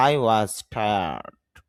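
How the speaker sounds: British tired with no r, very slow; British accent. Shown as a demonstration. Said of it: "British অ্যাকসেন্টে র উচ্চারণ না করলেই হয়".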